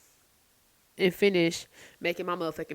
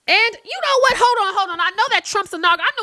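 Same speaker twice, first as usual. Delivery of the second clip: much higher and louder, hold on!; excited outburst; 14 semitones higher, 10 dB louder than usual. Real, not acted. Vlogger suddenly remembers the inauguration, loud excited outburst.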